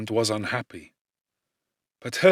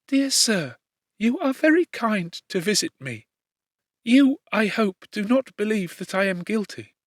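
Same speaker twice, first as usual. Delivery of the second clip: put-on gentle young woman; 8 semitones higher than usual. A narrator's reading. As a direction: voice a tender condemned girl. Justine voiced in reading.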